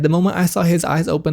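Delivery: ordinary talk, nothing marked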